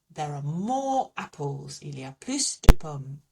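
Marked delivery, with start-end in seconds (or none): 1.8-3.2 plus, final S sounded, plooss; French plus, S pronounced. Shown as a demonstration. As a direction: say plus de pommes with a clear S on plus. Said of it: "I'm gonna pronounce that S".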